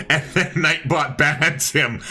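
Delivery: can barely get the words out for laughing. Real, not acted. Laughing while retelling chat.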